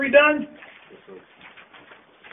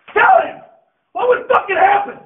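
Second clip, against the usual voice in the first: shouted demand; 4 semitones higher than usual. Real, not acted. Coach demanding a player answer him, shouted demand.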